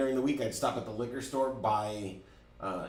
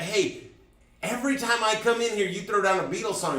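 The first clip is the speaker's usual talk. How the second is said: excited regular; 9 semitones higher, 6 dB louder than usual. Retold or imitated. Regular approaches DJ, retold.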